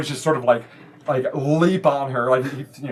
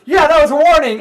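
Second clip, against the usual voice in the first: high, loud echo; acted defiant girl shout; backing-up girl; 14 semitones higher, 10 dB louder, faster than usual. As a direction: chime in loudly as a bold kid. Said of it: "She goes".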